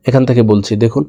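ordinary talk

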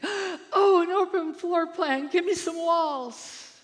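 high, loud, hammed-up craving; mock gushing outburst. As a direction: parody a swept-up home-show fan. Mocking home-show fans' sudden craving.

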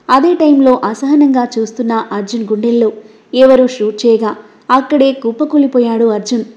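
plain reading voice

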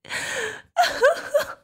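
high, shrill unhinged giggle; manic laugh. Acted. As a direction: cackle like she's lost it. Villain gloating.

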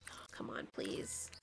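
tense whisper, pleading; hushed, urgent pleading; whispered plea. Real, not acted. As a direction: beg under your breath. Pleading at the game.

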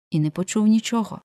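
ordinary delivery, nothing marked

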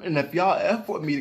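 ordinary talk

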